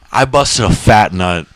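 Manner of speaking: ordinary talk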